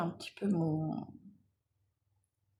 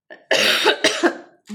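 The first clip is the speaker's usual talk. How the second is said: coughing fit; 11 semitones higher, 14 dB louder than usual. Real, not acted. Coughing fit mid-tutorial.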